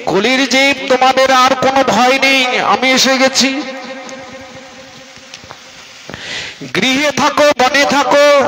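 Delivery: high, sustained preaching call; impassioned proclamation. Acted. Mahaprabhu promising people of the dark age he has come, impassioned. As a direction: proclaim it high to the whole crowd.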